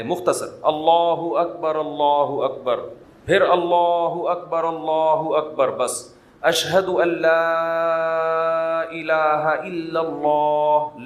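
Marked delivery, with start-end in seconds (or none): 0.6-5.7 slow, measured call to prayer; short adhan call. Shown as a demonstration. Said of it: "مختصر اذان دے". 6.4-11.0 لا held long, الہ kept short; slow adhan demo lengthening لا only; stretch on لا. Shown as a demonstration. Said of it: "لا پہ مد ہے".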